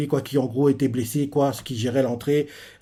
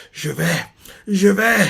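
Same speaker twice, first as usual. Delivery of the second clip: mock straining yell; 5 semitones higher than usual. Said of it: "Il est en train de crier".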